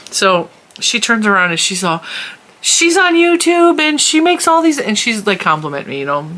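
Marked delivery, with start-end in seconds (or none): 2.6-4.8 much higher and a little louder, breathless bragging; gushing fan. Retold or imitated. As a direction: gush about her to your husband. Fan gushing to her husband.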